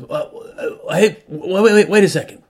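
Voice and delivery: building from hesitant to loud and high; calling him back. Retold or imitated. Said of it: "and then he goes".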